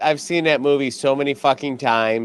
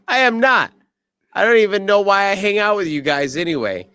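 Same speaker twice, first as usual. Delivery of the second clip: higher, quick whiny friend voice; mock whiny Squeak; 6 semitones higher, faster than usual. Squeak snapping back at his teasing teammates, higher and whinier. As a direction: play the picked-on friend, pitched up and fast. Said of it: "Squeak says".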